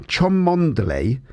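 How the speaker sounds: Cholmondeley sounded out as spelt; Cholmondeley in full, not shortened to Chumley; spelling pronunciation. Shown as a demonstration. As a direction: say every written syllable of Cholmondeley, slowly. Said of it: "the way it's spelt".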